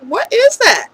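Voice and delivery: mock startled cry, high and loud. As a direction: yelp of alarm at a sudden noise. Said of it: "I'm like".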